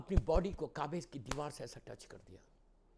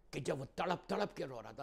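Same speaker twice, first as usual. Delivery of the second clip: ordinary delivery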